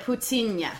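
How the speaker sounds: put-on "Putin" as poo-TEEN-yuh, palatal n at the end; Russian-style "Putin", the final n fully sounded; corrected pronunciation. Shown as a demonstration. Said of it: "It's kind of like".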